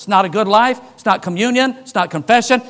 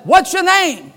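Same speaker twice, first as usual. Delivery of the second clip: raised, higher call; calling to a man over the fence; 9 semitones higher, 5 dB louder than usual. Retold or imitated. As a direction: shout the question across the yard. Calling question across the yard.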